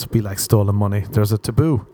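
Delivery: usual speaking voice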